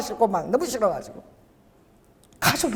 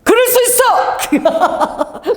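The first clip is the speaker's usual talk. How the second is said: high, drawn-out mock holler; even louder retort; 12 semitones higher, much slower than usual. Preacher replays the louder comeback on the phone, loud retort. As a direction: fire it back in a high, stretched yell. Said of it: "내가 더 큰 소리로".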